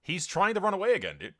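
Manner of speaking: high, loud disbelief; real indignant astonishment; incredulous. Real, not acted. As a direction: protest it in a high, exasperated voice. Foe dragon flees from him again.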